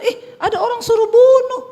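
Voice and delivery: high, loud, eager devil voice; the devil perking up at a chance; gleeful devil. Acted. Devil delighting as he overhears the couple's fight.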